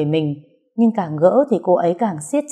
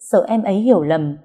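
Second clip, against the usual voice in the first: ordinary delivery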